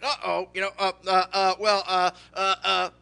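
acted loud, halting uhs; mock tongue-tied fluster; flustered stammering. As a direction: stall for words like someone caught out. Zacchaeus caught up in tree.

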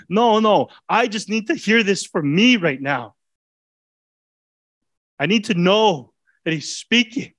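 high, pleading preacher voice; preacher building passion; fervent preaching. Real, not acted. Preaching at full force, raised.

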